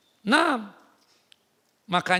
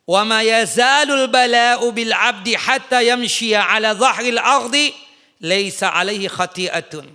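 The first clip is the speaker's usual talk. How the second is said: hadith recitation; 6 dB louder, slower than usual. Acted.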